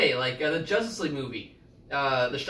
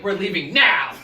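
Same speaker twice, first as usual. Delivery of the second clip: sudden loud call to leave, pitch up a bit; retold mom yelling; 3 semitones higher, 7 dB louder than usual. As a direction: bark it like a mom ending the outing. Mom yanking kids from theater.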